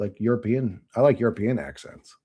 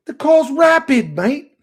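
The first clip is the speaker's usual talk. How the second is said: high, loud, drawn-out English impression; mock English accent; 8 dB louder, slower than usual. An Englishman thrilled by the fast car, mock English accent. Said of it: "he said some English shit".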